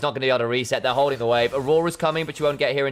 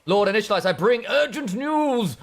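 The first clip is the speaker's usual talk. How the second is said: urgent panting report; 6 semitones higher than usual. Pretend. Acting a messenger to lord.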